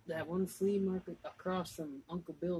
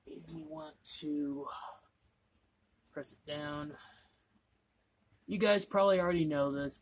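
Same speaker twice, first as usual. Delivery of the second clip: ordinary talk, nothing marked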